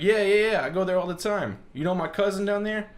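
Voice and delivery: high, loud, eager mock Boston local; bad Boston accent. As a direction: imitate an excited Boston guy finding common ground. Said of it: "I can't do a good Boston accent".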